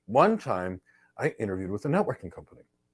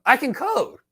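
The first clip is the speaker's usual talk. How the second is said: mock candidate voice; 11 semitones higher, 4 dB louder, slower than usual. Interviewer quizzes certified candidate.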